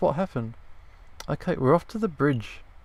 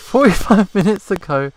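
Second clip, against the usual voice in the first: higher and louder, delighted disbelief; amused exclamation; 6 semitones higher, 10 dB louder than usual. Real, not acted. Amused outburst after learning the friend ate it long ago. Reacting, still laughing.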